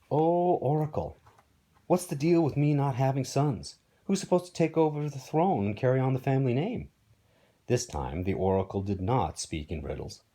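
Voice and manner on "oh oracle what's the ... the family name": pleading, higher-pitched king; desperate king. Acted. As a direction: beg the Oracle for an answer. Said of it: "Acrisius was desperate".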